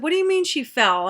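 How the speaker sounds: retold alarm. Alarmed mother questions the child.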